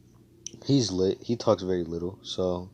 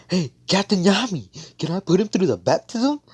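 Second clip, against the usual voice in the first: hammed-up excited request; eager calling out; 11 semitones higher, 6 dB louder than usual. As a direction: call out like an over-keen underling. Retold eager underling calling his captain.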